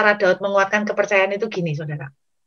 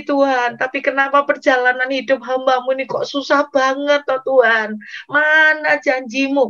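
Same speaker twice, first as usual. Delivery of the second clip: imitated anguished crying out; 4 semitones higher than usual. Preacher as David questioning God's promise, crying out in anguish. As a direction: act out a despairing, questioning cry. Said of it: "mungkin dia teriak sama Tuhan".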